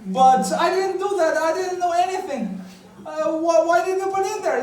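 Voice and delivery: high, incredulous pleading; indignant protest. Retold or imitated. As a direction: squawk it back in disbelief. Protesting to mother.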